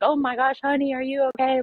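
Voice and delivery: high, motherly concern from a neighbor in a bathrobe; worried lady. Retold or imitated.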